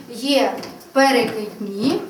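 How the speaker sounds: put-on slow, deliberate word for children; slow naming. Shown as a demonstration. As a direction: draw the word out. Teacher slowly names calendar types. (повільно і чітко)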